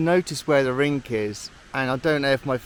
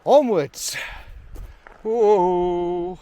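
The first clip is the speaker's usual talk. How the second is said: excited; 3 semitones higher, 6 dB louder, much slower than usual. Real, not acted. Pushing on up the trail.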